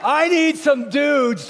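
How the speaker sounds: loud, high rally cry to the crowd; rallying shout. Real, not acted. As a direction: pump up the room, shouting. Pastor rallies crowd for competition.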